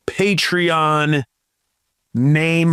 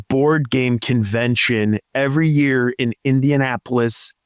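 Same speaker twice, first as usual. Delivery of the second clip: ordinary talk, nothing marked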